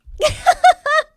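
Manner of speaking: high, loud burst of laughter; a big squealing cackle; sudden high shriek of a laugh. Real, not acted. Laughing at the insult.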